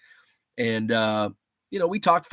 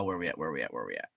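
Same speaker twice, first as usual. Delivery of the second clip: each repeat softer and lower, dying out; fading away; 12 semitones lower, 12 dB quieter, much faster than usual. Pretend. Scrolling back through chat, voice fading lower and softer. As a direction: let it trail off into nothing.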